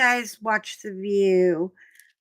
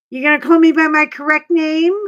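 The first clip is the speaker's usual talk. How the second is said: loud, raised mock demand; demanding shop owner; 6 semitones higher, 10 dB louder, faster than usual. Shop owner asked for his restroom, loudly demanding. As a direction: imitate an indignant man insisting on his name.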